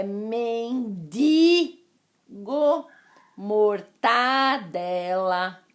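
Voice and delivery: mendigo and mortadela with no added n; slowly, mendigo not mendingo, mortadela not mortandela; no nasal. Shown as a demonstration. Teacher dictating the right form, slow and careful. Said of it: "sem esse som nasal".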